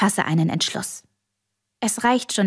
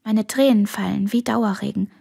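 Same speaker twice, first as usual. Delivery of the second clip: ordinary delivery, nothing marked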